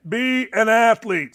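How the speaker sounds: "be an athlete" slow, each word set apart; deliberate emphasis. Real, not acted. Host just saying it to the dad, slow and deliberate. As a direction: spell out the advice slowly and evenly.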